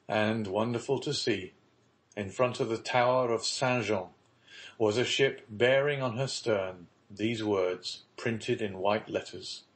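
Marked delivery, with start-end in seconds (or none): none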